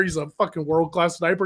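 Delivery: normal talking voice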